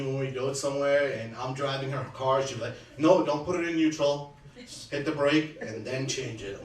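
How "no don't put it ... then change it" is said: bossy scolding. Retold or imitated. Sunny scolding her driving.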